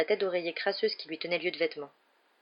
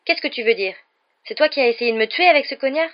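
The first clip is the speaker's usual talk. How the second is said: loud, high, angry demand; raised voice, indignant confrontation; rising anger; 7 semitones higher, 8 dB louder than usual. A narrator's reading. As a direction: accuse with returning anger. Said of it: "en sentant la colère revenir".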